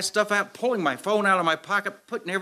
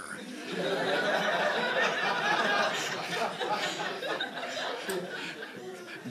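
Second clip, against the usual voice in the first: soft laugh; 3 semitones higher, 7 dB quieter than usual. Real, not acted. Laughing at his story.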